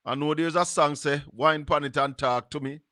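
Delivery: usual speaking voice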